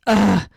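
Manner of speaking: loud reluctant "ugh"; exasperated groan. Retold or imitated. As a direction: groan before admitting bad news. Reluctant to explain what happened.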